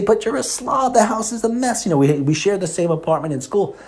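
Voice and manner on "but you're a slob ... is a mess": high-pitched whiny complaint; mock exasperated self. To his slob roommate, a higher mock complaint. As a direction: whine at the messy roommate. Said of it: "I would say".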